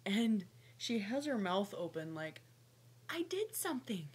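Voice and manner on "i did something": high happy pet voice. Pretend. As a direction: squeak it like a proud pet. Voicing the snake's proud look.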